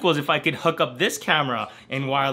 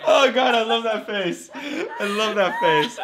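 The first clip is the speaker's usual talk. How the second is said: words squeezed out through laughter, higher; real laughing; 6 semitones higher, slower than usual. Real, not acted. Watching a friend's face in the clip, real laughing. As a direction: talk while cracking up.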